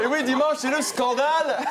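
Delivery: high, laughing redo of the line. Shown as a demonstration. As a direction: overplay it through laughter. Redoing the line with more punch.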